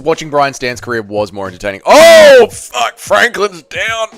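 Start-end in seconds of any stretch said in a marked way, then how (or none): 1.8-4.1 shocked. Real, not acted. Reacting to a knockdown live.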